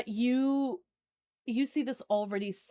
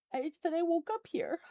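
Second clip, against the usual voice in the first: fake crying; 6 semitones higher than usual. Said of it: "I got goosebumps on that one".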